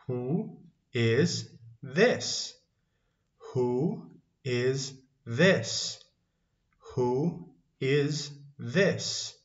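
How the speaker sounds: word-by-word with clear gaps; slow teaching model. Shown as a demonstration. Teacher gives learners a question to copy, slowly spaced out. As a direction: sound out each word on its own.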